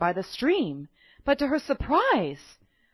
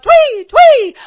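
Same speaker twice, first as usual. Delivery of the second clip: high, loud chirping birdcall; bird chirp; 17 dB louder than usual. A narrator's reading. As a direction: chirp it like a little robin. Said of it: "who called out".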